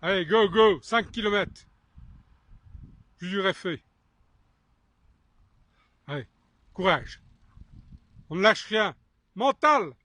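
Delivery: high-pitched cheering, pushing him on; barking gym coach. Real, not acted. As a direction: shout encouragement like a coach. Cheering on an exhausted cyclist.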